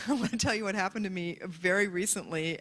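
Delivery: ordinary delivery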